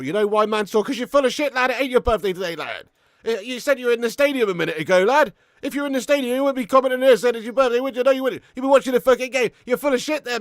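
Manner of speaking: high, loud, incredulous telling-off; heated calling-out. Real, not acted. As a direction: call out an obvious fibber. Calls out chatter's false birthday claim.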